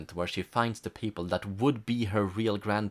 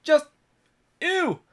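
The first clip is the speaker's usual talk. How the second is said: disgusted; 8 dB louder than usual. Real, not acted. Still grossed out.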